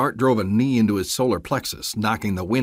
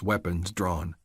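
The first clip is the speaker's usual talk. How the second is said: ordinary delivery, nothing marked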